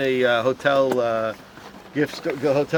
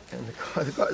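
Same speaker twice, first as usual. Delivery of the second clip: ordinary talk, nothing marked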